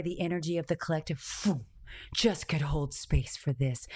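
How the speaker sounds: ordinary talk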